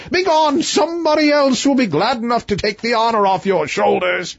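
high, furious royal threat; the king lashes out at the prince; angry king. Acted.

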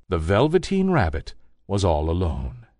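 ordinary delivery

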